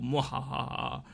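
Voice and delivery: slow, soft villain mwahaha; evil villain laugh. Retold or imitated. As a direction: cackle like a cartoon mad scientist. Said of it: "Because I am Mojo Jojo".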